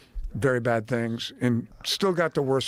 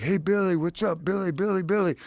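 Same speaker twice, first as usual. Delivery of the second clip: higher, excited fan calling Billy; 6 semitones higher than usual. Retold or imitated. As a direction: call out to him like a starstruck fan. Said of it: "kept calling me Billy".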